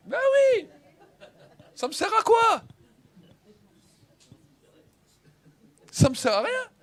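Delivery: high derisive aside; scornful rhetorical questions. Real, not acted. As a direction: scoff at the useless syllable hunting. Mocking useless scholarly detail.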